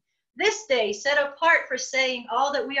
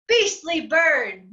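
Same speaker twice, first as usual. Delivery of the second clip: ordinary delivery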